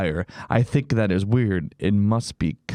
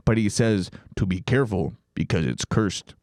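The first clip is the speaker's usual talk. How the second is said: ordinary talk, nothing marked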